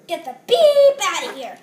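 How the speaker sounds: loud shout to get out; angry yell. Pretend. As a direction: yell it at the opponent. Wrestler yells at opponent.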